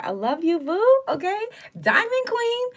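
high, loud shout of love; excited shout-out. Real, not acted. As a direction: call out thanks with joy. Thanking viewers excitedly.

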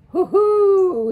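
loud, high, drawn-out owl hoot; owl wail. A narrator's reading. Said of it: "he wailed in his loudest but lowest voice".

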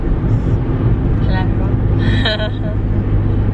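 drawn-out loud hello; presenter greeting. Pretend. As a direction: stretch out a hammy host greeting. Greeting camera in car.

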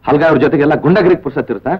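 angry accusation. Acted. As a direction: pitch climbing high with each accusing question. Jealous quarrel, rapid accusing questions.

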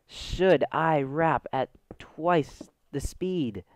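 very slow, word by word; teasing drawn-out question. Real, not acted. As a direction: stretch each word out with pauses.

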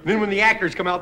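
ordinary talk

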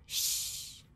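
long unvoiced shh; shushing sound. Shown as a demonstration. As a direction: hush them with a soft shh. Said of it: "faz esse som shh".

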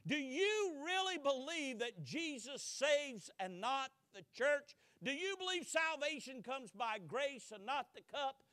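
loud, high incredulous grilling; interrogating. Retold or imitated. Court interrogating Luther, loud and incredulous. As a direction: press him hard with each question. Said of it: "this is the line of questioning".

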